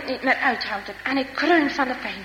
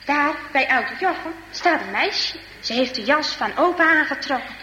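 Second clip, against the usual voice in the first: plain reading voice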